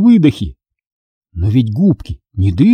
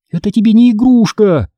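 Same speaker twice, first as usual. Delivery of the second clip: high-pitched indignant scolding; indignant little protest; 9 semitones higher, 3 dB louder than usual. A narrator's reading. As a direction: scold like an outraged child. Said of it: "возмутилась я".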